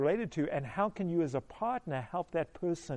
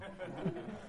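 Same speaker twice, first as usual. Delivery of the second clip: soft laugh; 2 semitones higher, 12 dB quieter than usual. Real, not acted. Laughing at own story.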